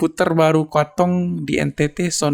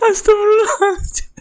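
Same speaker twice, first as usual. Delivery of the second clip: laughing exclamation. Real, not acted. Still laughing at video.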